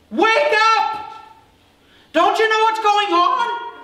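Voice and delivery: high, full-throated yell; acted frantic shouting; panicked waking shout. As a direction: scream to rouse him over the storm. Disciples yell to wake sleeping Jesus.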